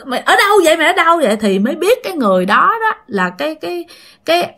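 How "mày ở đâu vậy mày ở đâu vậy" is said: startled demand. Retold or imitated. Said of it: "cô hai dằn ra được".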